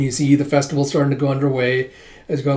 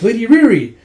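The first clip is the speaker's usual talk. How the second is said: high, loud delighted-greeting call; acted high call of greeting; 9 semitones higher, 9 dB louder than usual. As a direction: call the name out to someone arriving. Acted villager calls out a greeting.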